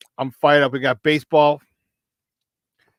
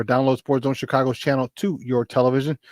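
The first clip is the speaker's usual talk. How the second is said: ordinary talk, nothing marked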